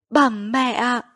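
mildly anxious call to mother; trembling call. A narrator's reading. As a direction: call out with a touch of dread. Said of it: "Quan huyện run rẩy gọi". (gọi hơi run rẩy)

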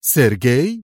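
astonished, glad questioning call; happy disbelief, rising at the end; joyful surprise. A narrator's reading. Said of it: "هتفت في دهشة فرحة".

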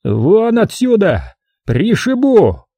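высокий, медленный злой рёв; рявкнуть в бешенстве; остервенелый крик. A narrator's reading. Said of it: "остервенел большой начальник". (остервенелый крик)

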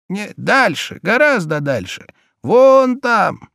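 put-on high old woman's voice; old peasant woman. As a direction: answer like a simple old peasant.

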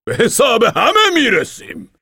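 put-on high, loud, slow old man's fiery oration; fiery speech. Old man promises revenge to the crowd, fiery high voice. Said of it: "سخنرانی پرشوری".

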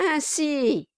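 sneering husband; Quilp, mocking. A narrator's reading. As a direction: taunt her from above. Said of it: "dit Kilp".

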